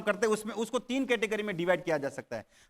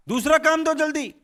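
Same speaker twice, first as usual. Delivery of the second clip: impatient demand; 7 semitones higher, 6 dB louder than usual. Acted. Ghost impatient for more work.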